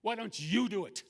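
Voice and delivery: high, loud retort; exasperated challenge. Retold or imitated. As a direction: throw the question back, exasperated.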